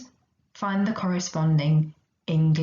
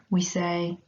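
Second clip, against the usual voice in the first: usual speaking voice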